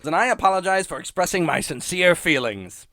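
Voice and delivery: high, put-on character voice; Marge. Mother making up with daughter, self-effacing imitation. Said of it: "Marge goes".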